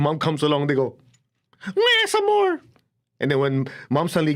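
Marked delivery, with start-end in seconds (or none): 1.8-2.6 high mock toddler wail; high-pitched pretend crying wah; fake baby wail. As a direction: wail like a toddler who saw mom. Child cries more.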